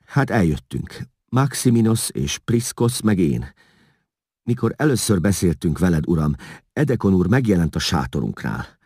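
lightly voiced interpreter. A narrator's reading. Vigilász explaining the money to the king, lightly pleading. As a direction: lightly plead your innocence as you explain.

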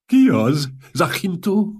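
put-on high, loud old-man cry of alarm; high-pitched, startled question. A narrator's reading. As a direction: jolt awake as a doddering old man. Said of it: "riadt fel, amint beléptek".

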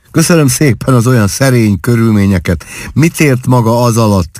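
mock them with mischief; impish, lightly mocking question; playful mockery. A narrator's reading. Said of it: "kérdi pajkos gúnnyal".

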